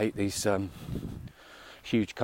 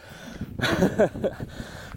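breathless laugh; 11 semitones higher than usual. Real, not acted. Laughs while climbing a hill.

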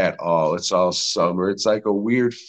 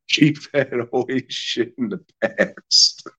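laughing; 6 semitones higher than usual. Real, not acted. Laughing while talking.

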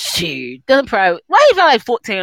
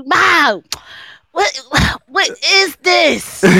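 higher, slow, halting demand; upset son; 5 semitones higher, much slower than usual. Retold or imitated. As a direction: imitate an appalled son confronting mom. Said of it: "he was so upset".

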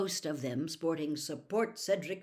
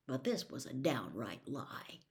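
plain reading voice

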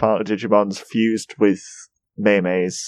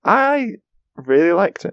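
keen; 9 semitones higher, slower than usual. Real, not acted. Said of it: "That's how many reallys I would give it".

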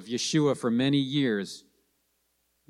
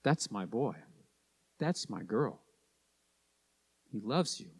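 soft, hushed fatherly pride; tender proud father; 5 semitones lower, 12 dB quieter than usual. Retold or imitated. Scene: preacher voices God proud of His children, softly. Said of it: "God really looks down and says".

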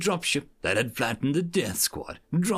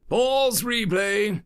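slow, higher, louder command; commanding instructor; 9 semitones higher, 4 dB louder, much slower than usual. Acted. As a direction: stop the tape with authority. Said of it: "a voice says".

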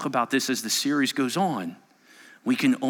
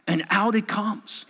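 usual speaking voice

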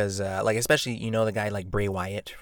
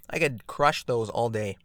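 ordinary talk, nothing marked

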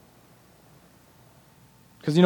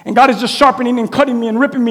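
ordinary talk

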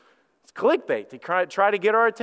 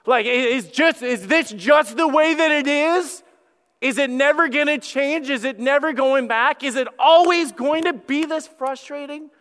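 frustrated pleading; 8 semitones higher, 4 dB louder than usual. Acted. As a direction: plead with God in exasperation. Acts out frustrated prayer to God.

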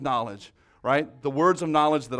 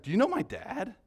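higher-pitched quizzical stranger; imitated puzzled question; 6 semitones higher, 3 dB quieter than usual. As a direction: imitate a baffled young man asking. Retold stranger asks, puzzled.

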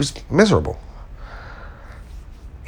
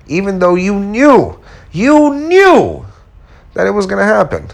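much higher, louder self-rebuke; scolding himself; 10 semitones higher, 4 dB louder than usual. Retold or imitated. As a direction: hammer yourself with it. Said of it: "But then I was like".

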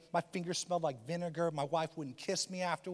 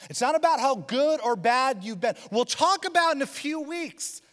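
raised, earnest preaching voice; 8 semitones higher, 6 dB louder than usual. Acted. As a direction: preach it out to the congregation. Preaching to congregation.

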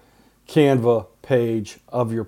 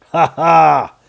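gleeful laugh; 5 dB louder than usual. Real, not acted. Excited about Cold War TikToks.

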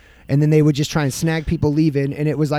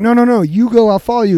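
urgent insistence; 6 semitones higher than usual. Retold or imitated. Retold urgent objection to friend.

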